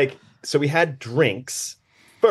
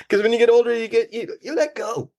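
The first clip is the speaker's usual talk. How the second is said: mock elder; 10 semitones higher than usual. Mock wise elder advice.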